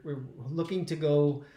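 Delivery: usual speaking voice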